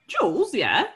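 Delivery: accidental Dutch-accented slip; lightly Dutch-sounding lilt. Real, not acted. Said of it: "I said that in a Dutch accent".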